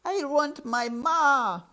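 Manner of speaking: very high, loud, drawn-out wail; crying out. A narrator's reading. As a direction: wail slowly for a lost mother. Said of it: "cried Mig".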